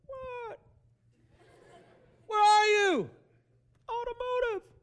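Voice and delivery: high, drawn-out holler; long-distance shouting. Acted. Husband and wife locating each other across Walmart, high hollering. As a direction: yell back and forth across a big store. Couple yelling across the store.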